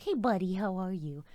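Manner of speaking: pet voice. Retold or imitated. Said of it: "So I'll be like".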